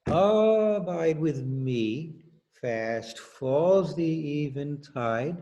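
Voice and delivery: stretched slowly along the beat; slow, in the musical rhythm. Shown as a demonstration. Teacher reading the hymn text slowly in its musical meter. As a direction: read it the way the hymn's rhythm makes you. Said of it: "Without the musical rhythm".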